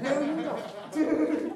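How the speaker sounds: loud startled laughter; laughing loudly. Real, not acted. Laughing after scarf incident.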